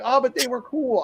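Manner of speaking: imitating the coworker. Coworker excusing himself to the trainer, high mock voice. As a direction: play the clueless guy shrugging it off. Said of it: "he'd be like".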